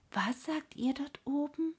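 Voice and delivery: ordinary delivery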